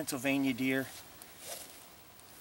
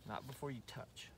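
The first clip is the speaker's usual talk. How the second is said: quiet; 20 dB quieter than usual. Real, not acted. Closing tip said softly.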